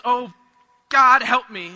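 loud, mock-panicked cry for help; acted burst of panic; panicked plea. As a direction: blurt an urgent plea to God. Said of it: "Those moments of panic".

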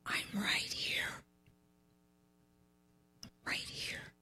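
very soft whispered voice; inner whisper. Retold or imitated. God answering her in her heart, soft whisper. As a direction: breathe it like a voice in the heart. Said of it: "not out loud".

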